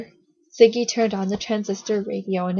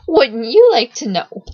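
cheeky, louder, with a leap on YOU; snickering taunt; 3 semitones higher, 9 dB louder than usual. A narrator's reading. Strange boy dodging Siggy's question, snickering taunt. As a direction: toss it out as a teasing dodge. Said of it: "The boy snickered".

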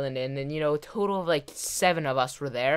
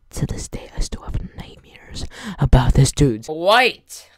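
slow whisper breaking into a loud cry; mock-traumatized hush, then outburst; haunted whisper; 8 dB louder than usual. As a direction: whisper the confession, then burst out at the end. Haunted confession, played for effect.

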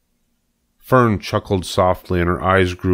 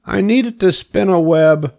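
high-pitched pig voice; eager pig; 12 semitones higher, 3 dB louder than usual. A narrator's reading. Pig tells rat his plan, eager. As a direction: explain it as a keen little pig.

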